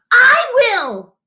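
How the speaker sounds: high, loud eager little dragon; eager declaration. A narrator's reading. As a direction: jump in to volunteer. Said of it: "declared Crispin".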